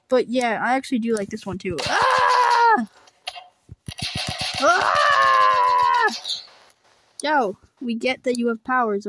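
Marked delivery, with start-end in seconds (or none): 1.9-6.1 high, loud startled screams. Acted. Character hit by a friend's powers screams high and loud. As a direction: scream as you're thrown.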